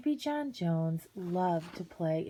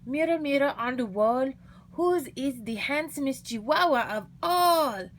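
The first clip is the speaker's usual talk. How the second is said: put-on high, theatrical Spanish accent; 7 semitones higher than usual. A narrator's reading. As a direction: play-act a grand Spanish accent. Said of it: "using his very best Spanish accent".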